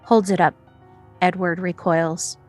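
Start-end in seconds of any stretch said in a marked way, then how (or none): none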